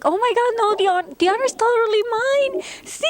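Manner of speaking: very high gushing reenactment; squealed, retold thanks; super excited. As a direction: gush back, voice way up. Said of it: "I was super excited".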